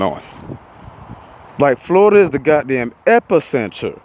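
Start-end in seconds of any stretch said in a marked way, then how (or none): none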